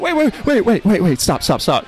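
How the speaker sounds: mock panic, high and loud; hammed-up frantic pleading; panicked. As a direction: replay the panic, yelling wait. Retelling panic before the exam.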